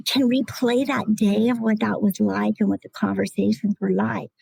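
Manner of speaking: ordinary talk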